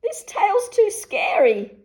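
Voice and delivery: high, loud frightened wail; wolf fleeing the giant; scared cry. A narrator's reading. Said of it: "cried the wolf".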